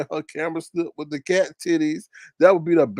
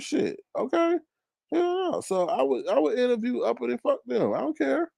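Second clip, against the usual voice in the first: higher, softer, brushing it off; dismissive; 8 semitones higher, 4 dB quieter than usual. Real, not acted. To chat about Uppity, high soft dismissal. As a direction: toss it away lightly.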